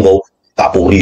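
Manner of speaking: usual speaking voice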